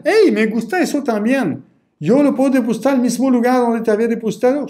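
high, fast, eager buyer voice; eager customer. Retold or imitated. Coach acts out a returning client asking to pay, eager and fast. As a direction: play the returning customer, bright and quick.

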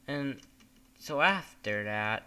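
normal talking voice

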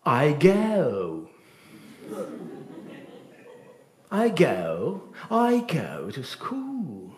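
proper English diphthongs in "I go", slow and careful; English accent. Shown as a demonstration. Teacher showing the English way to say I go to school. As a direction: say "I go to school" like a native, gliding the vowels. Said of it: "en anglais".

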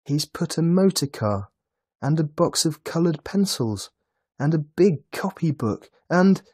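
put-on high, loud, breathless-with-joy child; excited child's voice, exclaiming in delight; overjoyed little boy. A narrator's reading. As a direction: gush over presents to mother. Boy thrilled by his Christmas presents.

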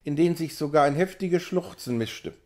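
usual speaking voice

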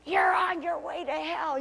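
a hint of a shouting zealot; imitating angry man. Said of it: "He is shouting and screaming".

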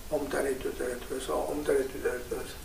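soft, low murmured mantra; murmured mantra, barely audible; quiet recitation. Shown as a demonstration. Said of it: "quiet enough that you can hear".

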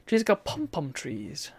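ordinary talk, nothing marked

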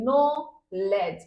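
"Knowledge" very slow, split as নো, লেজ; two separate beats. Shown as a demonstration. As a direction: break "knowledge" into two drawn-out syllables. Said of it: "দুইবার উচ্চারণ করেছি". ("Knowledge" দুই ঝোঁকে, টেনে টেনে)

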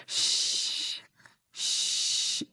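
শ্বাস as a very soft voiceless hiss; just breath for শ্বাস; whispered hiss of air; air only. Shown as a demonstration. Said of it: "এটাকেই practice করতে হবে".

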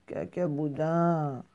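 slow, lazy morning answer; drawn-out reply. Acted. Little rabbit answering maman in the morning, slow drawl. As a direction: stretch the syllables out slowly.

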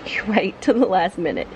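ordinary talk

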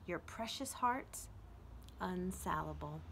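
slow, uplifting poem recital; poem reading. Acted. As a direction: read it as a gift of encouragement. Reads poem Majestic aloud.

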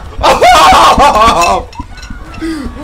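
loud, high hype laughter; whooping laugh; excited laugh. Real, not acted. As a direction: burst out in a big high laugh. Excited reaction to trailer.

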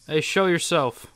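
ordinary delivery

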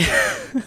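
squeaky frightened giggle; high nervous laugh. Real, not acted. Nervous after hiding.

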